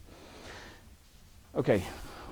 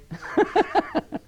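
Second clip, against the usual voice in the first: a high, falling burst of laughter. Real, not acted. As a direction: laugh at your own teasing. Laughs at own joke.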